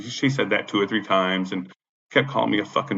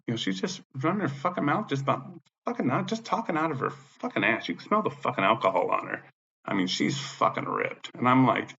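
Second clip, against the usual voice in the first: usual speaking voice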